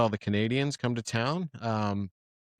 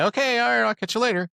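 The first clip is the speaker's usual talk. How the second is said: higher, breezy sign-off; mock breezy goodbye; 10 semitones higher than usual. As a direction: toss off a quick cheery goodbye. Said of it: "Am I being rude if I say".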